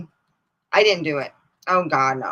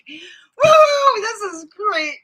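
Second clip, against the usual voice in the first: mock excited woo; 8 dB louder, much slower than usual. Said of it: "I'm like".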